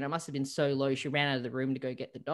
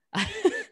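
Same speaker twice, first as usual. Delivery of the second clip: high laugh; 5 dB louder than usual. Real, not acted. Laughs at her own success.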